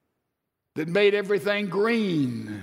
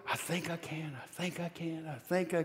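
soft, hushed chant of a straining little engine; little engine chugging; 2 semitones lower, 11 dB quieter than usual. Retold or imitated. Preacher recalling his mother's story, soft puffing engine voice. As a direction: murmur it like a toy train pushing uphill. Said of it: "The engine goes up the hill".

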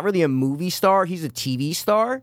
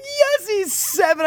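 high, strained replay of the moment; retold excited cry; much slower than usual. Co-host replaying his reaction to the answer, excited cry. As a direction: squeal it as if reliving the reveal.

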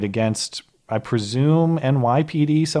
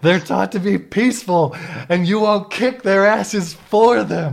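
higher, louder mock rant; mocking imitation; 8 semitones higher, 4 dB louder than usual. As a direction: parody her outrage, slowing down. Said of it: "mimicking the professor's rant".

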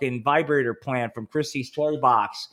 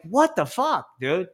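disbelief; 6 semitones higher, slower than usual. Retold or imitated. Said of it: "I was like".

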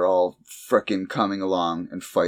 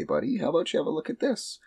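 higher, quick friendly voice; kind helper voice; 9 semitones higher, 4 dB quieter, faster than usual. Retold or imitated. Bellhop cell consoling the kid, high friendly voice. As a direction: offer it gently and brightly. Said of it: "And he's like".

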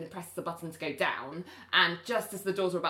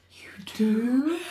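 whisper; 4 semitones higher, 4 dB louder than usual. Retold or imitated. Ali answers Jason in lift.

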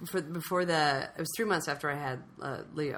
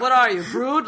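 loud, higher mock Dutch accent; bad Dutch accent; 5 semitones higher, 9 dB louder than usual. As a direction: challenge them in a shaky Dutch accent. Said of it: "that's not my Dutch accent".

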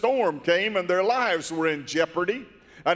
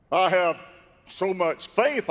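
ordinary talk, nothing marked

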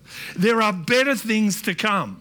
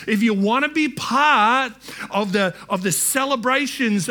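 raised, driving preacher's voice; impassioned preaching; 4 semitones higher than usual. Real, not acted. Preacher urging the church to hunger for God, impassioned and higher. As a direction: press each point urgently on the congregation.

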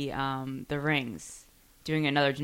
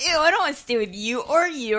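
mocking whiny voice; 8 semitones higher than usual. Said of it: "And you're like".

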